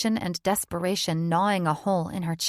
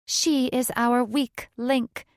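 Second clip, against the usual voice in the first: higher, slow accusation; raised pitch, drawn-out words; ringing out; 6 semitones higher, slower than usual. A narrator's reading. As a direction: call it out deliberately to the coven. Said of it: "a separate voice rang out".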